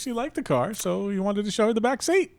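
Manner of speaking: high voice just out of laughter; amused, high-pitched. Real, not acted. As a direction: squeal it out, cracked up by the joke.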